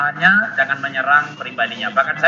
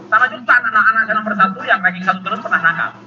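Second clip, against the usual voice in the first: normal talking voice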